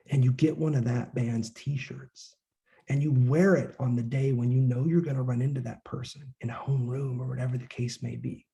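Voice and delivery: normal talking voice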